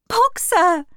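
high, loud cry of a name; desperate shout. A narrator's reading. Clover shouts after Boxer in the lorry, desperate. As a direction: call out urgently after him. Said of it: "she shouted".

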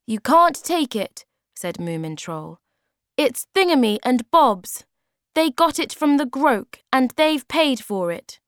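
put-on high, loud indignant child voice; protesting Moomin Troll. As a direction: stand up for his friends.

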